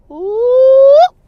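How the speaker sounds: high, loud drawn-out whoop; loud whoop yell. Pretend. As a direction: sound of a beatdown coming. Reader mimics a smack.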